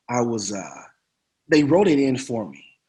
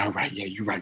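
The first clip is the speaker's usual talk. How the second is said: sheepish agreement; 6 semitones lower, 12 dB quieter than usual. Retold or imitated. Meekly giving in to Sam.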